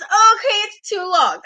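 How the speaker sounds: high, loud griping; mock fan complaint. Host voicing fickle wrestling fans, mock complaining. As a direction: imitate fickle fans whining loudly. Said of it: "wrestling fans complain".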